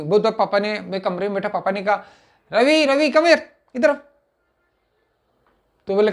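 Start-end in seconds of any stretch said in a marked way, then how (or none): 2.5-4.0 raised, higher calling voice across the house; calling out loudly. Retold or imitated. Father summons son from his room, calling out loudly. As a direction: call the boy in from another room.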